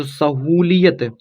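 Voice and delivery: सहूलियत drawn out slowly, long hoo; slow sounding-out. Shown as a demonstration. Teacher showing how to build the word, slow drawn-out syllables. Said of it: "ऐसे आप बनाइए ना".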